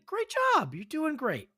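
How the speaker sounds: high, bright-up cheer of praise; excited praise. Real, not acted. Host cheers contestant's answer.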